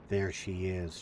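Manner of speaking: usual speaking voice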